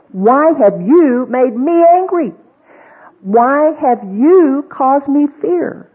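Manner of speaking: hammed-up nagging mother, drawn out; mock angry mother. Preacher voicing a mother blaming her child, slow mock anger.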